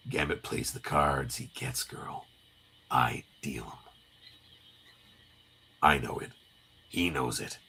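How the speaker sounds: very low, soft, near-whispered menace; brooding comic-book tough guy. Acted. Wolverine answering Jubilee about Gambit, low menace. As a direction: keep it low and hushed. Acting Wolverine's terse reply.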